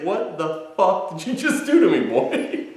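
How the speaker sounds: half-laughed mock outrage, fading to a whisper; giggly mock cat, trailing off unvoiced; laughing cat voice. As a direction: voice the stunned cat while cracking up. Voicing the shocked cat.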